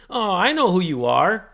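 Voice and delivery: exaggerated smug "oh, it's you" tone; smug lawyer. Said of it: "Just in this tone".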